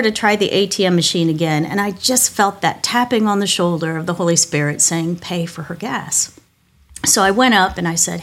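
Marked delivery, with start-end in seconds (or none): 5.2-6.4 soft inner prompting. Retold or imitated. Holy Spirit prompting her, voiced.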